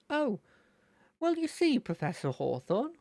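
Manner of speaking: excited high character voice; Terry. Acted. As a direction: launch high from an "Oh". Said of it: "trigger sort of phrase is gonna be".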